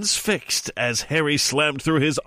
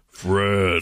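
low voice; 6 semitones lower than usual. Shown as a demonstration. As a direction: drop the voice low. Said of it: "in a low voice from behind them".

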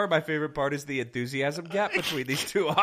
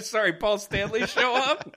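ordinary talk

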